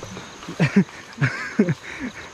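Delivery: soft, voiceless chuckling; real chuckle. Real, not acted. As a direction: laugh quietly in short bursts. Amused by teasing about his skin.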